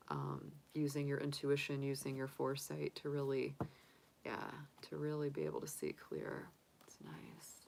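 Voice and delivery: sniffly and fading to a whisper. Real, not acted. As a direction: talk through a stuffy nose, softly. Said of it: "Sorry, I'm sniffly".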